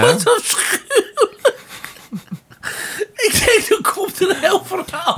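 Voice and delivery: high, laughing through words; barely talking through laughter; laughing hard. Real, not acted. S1 in fits of laughter. (slap van het lachen)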